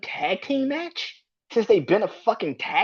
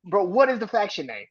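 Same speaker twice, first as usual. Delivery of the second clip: normal talking voice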